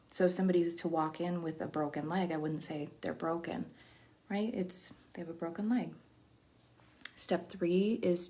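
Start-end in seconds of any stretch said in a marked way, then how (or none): none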